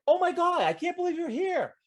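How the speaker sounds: high, delighted surprise at seeing him; excited. Retold or imitated. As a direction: burst out happily, way up high. Excited reunion greeting in game.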